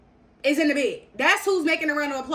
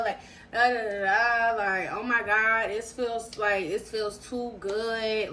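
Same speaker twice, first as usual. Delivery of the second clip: imitating his moaning; 4 semitones lower, slower than usual. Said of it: "he's, like, one of my name".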